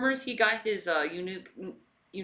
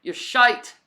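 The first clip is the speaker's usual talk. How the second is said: hammed-up British accent, higher and louder; mock British insult; 8 semitones higher, 9 dB louder than usual. Jeering at the absent influencer for fun, in a hammed-up British accent. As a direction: jeer like a rowdy British lad. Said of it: "I just like doing accents".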